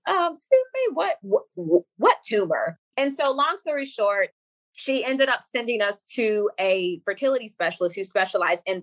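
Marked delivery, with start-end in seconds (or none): 0.1-2.8 high stammered disbelief, dropping at the end; flabbergasted. Retold or imitated. Patient replying to a too-casual doctor, flabbergasted. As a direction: play up the baffled double take.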